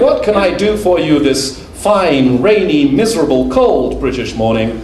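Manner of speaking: a shopkeeper chatting about rainy weather to a customer; chatty shopkeeper. Retold or imitated. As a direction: relish the gloomy weather like a jolly shopkeeper. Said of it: "the shopkeeper goes".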